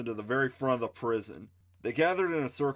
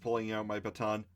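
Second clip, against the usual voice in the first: usual speaking voice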